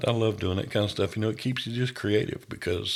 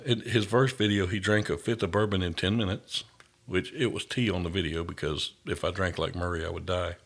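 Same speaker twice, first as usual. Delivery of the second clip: ordinary delivery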